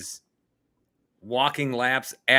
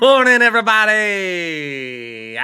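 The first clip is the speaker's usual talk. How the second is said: big greeting; 9 semitones higher, 10 dB louder, much slower than usual. Real, not acted. Loud drawn-out greeting to listeners.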